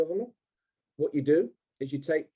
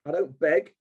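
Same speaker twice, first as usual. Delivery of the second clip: normal talking voice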